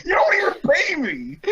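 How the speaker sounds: very high, hammed-up wailing complaint; squealed mock-tearful protest; mock tantrum. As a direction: cry it out in a fake meltdown. Acted tantrum in author's notes.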